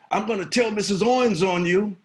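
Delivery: high, loud playground threat; threatening kids. Retold or imitated. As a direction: warn the kids you'll tell on them. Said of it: "I would always tell them".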